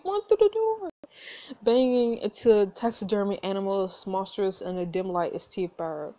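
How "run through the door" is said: high, panicked urging. Real, not acted. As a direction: urge it in panic.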